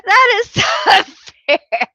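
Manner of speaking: high mock complaint broken by a laugh; teased by a viewer, squeaky laughing protest. Real, not acted. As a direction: protest while you can't stop laughing.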